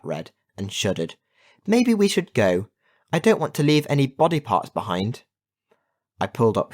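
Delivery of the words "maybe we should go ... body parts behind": high-pitched, uneasy suggestion; nervous. A narrator's reading. Kat shuddering at witches' teeth, nervous. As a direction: voice raised in worry.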